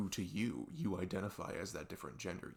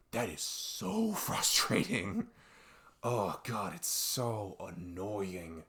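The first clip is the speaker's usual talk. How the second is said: louder, drawn-out exasperation; exasperated; 7 dB louder, much slower than usual. Real, not acted. Venting to viewers about being told they're wrong, loud and exasperated. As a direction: stretch the words out, fed up.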